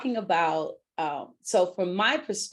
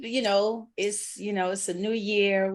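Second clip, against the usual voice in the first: ordinary talk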